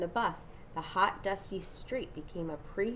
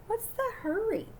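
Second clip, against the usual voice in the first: high puzzled call; 13 semitones higher than usual. A narrator's reading. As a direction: call after him, puzzled. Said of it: "called Frank".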